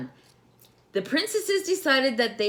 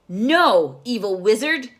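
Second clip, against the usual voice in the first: loud, firm refusal; defiant no; 3 semitones higher, 8 dB louder than usual. Acted. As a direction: stand up to the wizard. Princess defies wizard.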